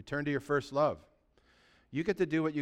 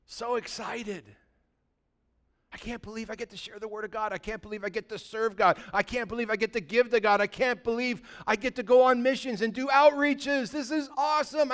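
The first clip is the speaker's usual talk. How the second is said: higher, gushing excitement; can't contain the joy of serving; gushing volunteer; 9 semitones higher, 5 dB louder than usual. Acted. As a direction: church member gushing about serving God, excited. Acting an eager church member.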